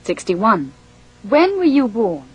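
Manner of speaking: usual speaking voice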